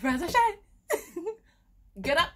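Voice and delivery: high, loud yelling to wake him. Retold or imitated. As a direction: shout him awake, half laughing. Trying to wake the flatmate.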